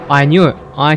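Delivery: loud, excited yell; triumphant shout. Real, not acted. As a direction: shout it like you called it. Predicted the jump scare.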